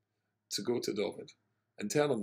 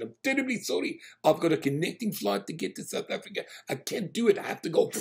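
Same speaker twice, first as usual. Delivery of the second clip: hasty excuse; 8 semitones higher, 7 dB louder than usual. Retold or imitated. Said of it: "He said".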